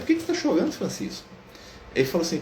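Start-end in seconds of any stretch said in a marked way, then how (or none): 0.0-1.3 much higher, tender questioning voice fading to a breath; Jesus asking gently. Retold or imitated. Jesus asking the weeping Francis why he cries, high and gentle. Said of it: "E Jesus pergunta pra ele".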